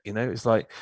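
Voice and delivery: usual speaking voice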